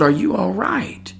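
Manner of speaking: soft, slow, concerned question; calm, quiet. Retold or imitated. Checking on the shaken woman, calm and quiet. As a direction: ask gently, drawing it out. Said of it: "in a very calm, quiet manner".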